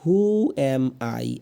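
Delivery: very slow, drawn-out who am I; slow model question. Shown as a demonstration. Teacher models the question slowly for students. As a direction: stretch each word for learners. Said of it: "এরপরে দিয়ে আছে".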